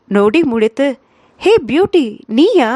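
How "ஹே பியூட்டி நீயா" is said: startled, higher and a bit louder; surprised greeting. A narrator's reading. Ananthan surprised to hear Jeevitha on the phone, startled. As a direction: caught off guard, recognising her voice.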